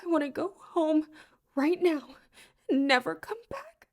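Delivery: high, tearful and trembling; barely holding back sobs; quavering, wet voice. Acted. Heather begging to leave after the curse, quavering and tearful. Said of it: "in a quavering, wet voice".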